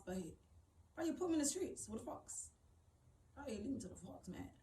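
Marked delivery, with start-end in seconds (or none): none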